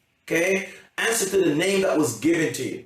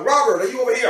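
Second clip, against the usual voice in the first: calling out; 7 semitones higher, 4 dB louder, much faster than usual. Acted. Acts handing out presents.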